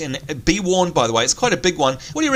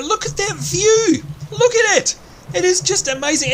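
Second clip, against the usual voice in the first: much higher, thrilled exclaiming; excited; 13 semitones higher than usual. Real, not acted. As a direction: gush over the view. Gasping at game scenery.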